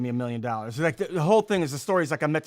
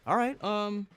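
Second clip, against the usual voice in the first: halting, higher mock hesitation; imitating a dad awkwardly gathering himself; pausing, then agreeing; 6 semitones higher than usual. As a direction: stall with fillers, then get down to business. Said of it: "takes the pause and he's like".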